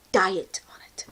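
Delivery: secretive whisper; whispered confession to viewers. Real, not acted. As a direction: drop to a confiding whisper. Confiding aside about diet soda.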